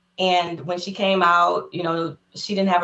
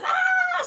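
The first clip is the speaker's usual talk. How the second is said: mock scream. Oprah teasing the fans, high mock shriek. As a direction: echo the fans' scream in fun. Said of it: "So she started screaming".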